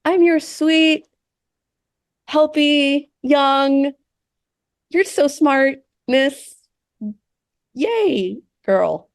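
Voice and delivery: high, slow sugary assistant; mocking high sugary AI girl; sweet young helper voice. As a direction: coo it like a sweet eager helper. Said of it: "The vibe is like".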